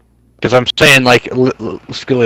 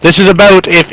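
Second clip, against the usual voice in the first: heated; 6 semitones higher than usual. Real, not acted. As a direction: push back, fed up. Heated argument over the vote.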